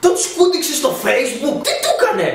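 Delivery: high, laughing incredulity; squeaky amused disbelief. Real, not acted. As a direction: laugh out the questions.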